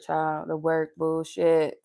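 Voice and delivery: ordinary talk, nothing marked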